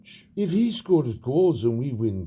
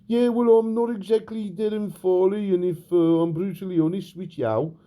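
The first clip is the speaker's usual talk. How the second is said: put-on high, broad Brummie accent; a mock manager voice done in a Birmingham accent instead of a Scouse one; Steven Gerrard in Brum accent; 4 semitones higher than usual. Mock Gerrard justifies his voice to the interviewer, Brummie accent. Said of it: "me Brum accent is better than me Scouse one".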